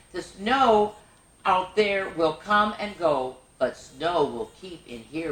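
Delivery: sing-song rhyming read-aloud; bouncy picture-book reading; reading to children. Acted. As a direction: lilt each line for little listeners. Reads rhyming snowman book aloud.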